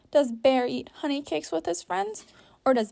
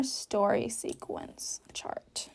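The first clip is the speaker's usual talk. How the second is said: whisper; 4 semitones lower, 8 dB quieter, slower than usual. Real, not acted. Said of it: "whispered while placing".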